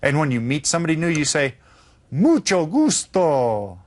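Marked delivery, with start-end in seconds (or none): 2.1-3.8 very slow, clear Estoy bien, gracias; slow model answer. Shown as a demonstration. Teacher modelling the answer slowly for repetition. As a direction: spell out each word for the class.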